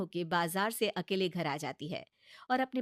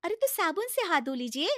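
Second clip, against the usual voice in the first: higher, coaxing bahu voice; pleading daughter-in-law; 6 semitones higher, 7 dB louder than usual. Acted. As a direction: talk sense into a stubborn mother-in-law. (ऊँची, मनाती हुई बहू की आवाज़)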